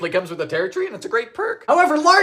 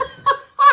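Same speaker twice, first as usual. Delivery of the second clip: squeaky burst of giggles; high giggle; 5 dB louder than usual. Real, not acted. Host giggling over the bacon smell, high squeak. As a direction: giggle uncontrollably.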